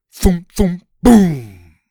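loud, punchy sound-effect words; storyteller acting out a heavy crash landing; booming impact words. A narrator's reading. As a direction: hit each thud hard, biggest on boom. Narrator voices the landing impacts.

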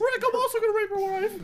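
very high squeaky Morty voice. Acted. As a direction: squeal it in character. Morty voice joke.

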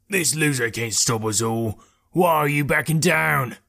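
rough-edged character voice, rising at the end; bellowing bandit leader. A narrator's reading. Bandit leader to his retreating men, bellowing. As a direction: berate your men as the bandit boss. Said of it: "the third bellowed at them".

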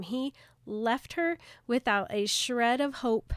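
normal talking voice

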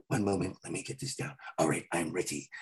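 low, soft collecting himself; low murmured aside. Retold or imitated. Actor gathering himself after flubbing lines, low and soft. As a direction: murmur it to yourself.

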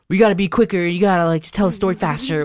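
lightly voiced impatient partner; a hint of a pushy girlfriend; imitating girlfriend. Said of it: "And she's like".

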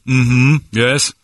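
high, loud cartoon English accent; hammed-up British gibberish; mock British accent. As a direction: needle him in a silly English accent. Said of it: "You're doing the accent again".

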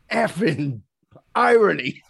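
high, loud laughing exclamation on "irony"; laughing disbelief. Retold or imitated. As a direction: blurt it out half laughing, disbelieving. Tony's laughing retort, voiced.

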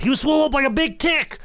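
a hammed-up wrestler shout; imitating George South. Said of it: "In the words of George South".

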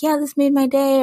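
excited fan. Retold or imitated. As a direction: quote a thrilled listener brightly. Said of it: "other people are like".

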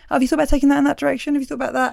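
breezy suggestion from a comic friend; mate giving notes. Retold or imitated. Comic mate offering a quick note to a friend, lightly voiced. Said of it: "give each other a few notes".

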